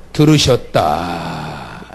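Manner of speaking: 들으셨다 stretched low; slow low answer. Shown as a demonstration. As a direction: drag out 들으셨다 deep and slow. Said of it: "뭐라고요?".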